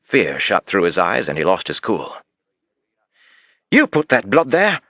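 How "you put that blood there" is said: panicked yell. Acted. Suspect accusing the detective of planting blood, panicked yell. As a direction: lash out in a panic. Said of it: "he yelled at me".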